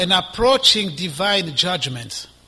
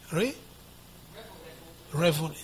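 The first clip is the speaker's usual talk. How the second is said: normal talking voice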